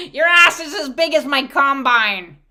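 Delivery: brash husband's holler; hollered crude teasing. Acted. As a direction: shout it across the field at the wife. Husband hollers crude joke at wife.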